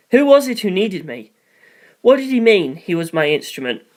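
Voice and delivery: usual speaking voice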